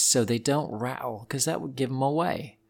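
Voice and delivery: usual speaking voice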